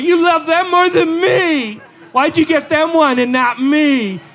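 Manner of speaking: put-on high, slow, wailing kid voice; boy accusing his parents, mock sobbing; whiny crying boy. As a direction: whine like a hurt little boy. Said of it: "And he's crying".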